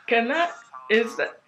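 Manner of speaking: can't believe what she sees; stunned disbelief. Retold or imitated. Fan spotting the band's tour bus, stunned and stammering.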